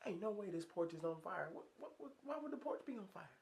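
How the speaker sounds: inner disbelief. Retold or imitated. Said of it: "thinking to myself".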